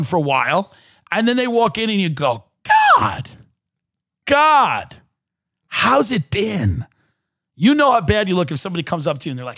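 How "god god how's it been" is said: mock shock. Shocked at someone's looks.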